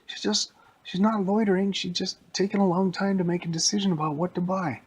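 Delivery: high-pitched pleading sidekick voice; Robin. Acted. Sidekick urging Batman not to boomerang an old lady, high-pitched protest. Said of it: "And Robin was like".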